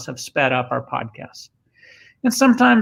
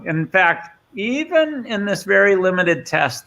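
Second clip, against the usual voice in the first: normal talking voice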